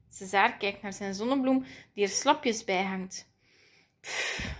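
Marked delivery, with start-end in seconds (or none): 4.0-4.5 soft, airy puff; quiet puff of discouragement; dejected sigh. A narrator's reading. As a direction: let out a deflated breath. Dejected sigh at drooping flower.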